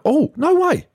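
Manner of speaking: squeaky high mock amazement; mock amazed. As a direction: gasp it out in fake wonder.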